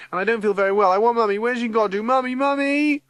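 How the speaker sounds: high wail rising to a loud cry; whine, then bawl for mother; childish wail. A narrator's reading. Lucy feeling unwell and wanting Mummy, wailing.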